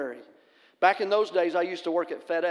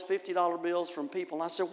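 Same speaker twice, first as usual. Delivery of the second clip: ordinary talk, nothing marked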